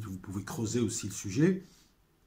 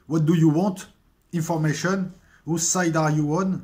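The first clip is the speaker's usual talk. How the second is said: put-on louder, higher English lines with a French accent; dialogue récité; 4 semitones higher, 6 dB louder than usual. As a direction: replay the series' interrogation as both men. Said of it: "ce dialogue qu'on retrouvera à chaque épisode entre lui et le numéro deux". (dialogue du Prisonnier joué, plus fort et plus aigu)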